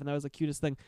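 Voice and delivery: ordinary delivery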